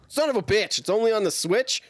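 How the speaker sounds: high, loud disbelieving outburst; exasperated realization; indignant surprise. Retold or imitated. Retelling his dismayed outburst.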